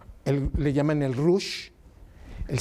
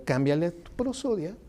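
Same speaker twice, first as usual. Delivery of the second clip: ordinary delivery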